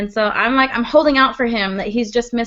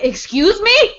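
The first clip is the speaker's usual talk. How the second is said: shocked; 9 semitones higher, 3 dB louder than usual. Retold or imitated. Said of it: "they're like".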